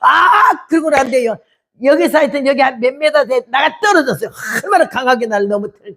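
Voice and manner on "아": high, drawn-out cry of shock; imitated startled scream; re-enacted scream. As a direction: yell as if struck by lightning. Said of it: "벼락 맞아 죽는 줄 알아서".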